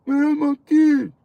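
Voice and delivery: high, slow, whiny put-on voice; mock whiner. Mimicking a man upset about his kid, high mock whine. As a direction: stretch it out in a squeaky whine.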